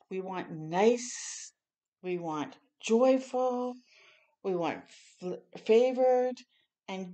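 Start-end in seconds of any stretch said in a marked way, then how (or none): none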